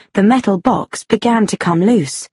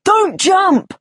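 high-pitched, urgent warning; calling up; 11 semitones higher than usual. A narrator's reading. Man below to woman at the window, calling up. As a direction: cry out a plea from below. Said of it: "called a voice from the street below".

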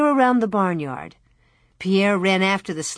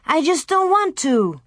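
put-on sulky little boy; 9 semitones higher than usual. A narrator's reading. Narrator voices Louie.

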